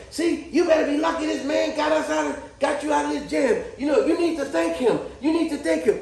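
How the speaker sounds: a hint of a fussing grandmother; scolding old lady. Old lady scolding her grandbaby about the locksmith, mildly imitated. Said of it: "She goes".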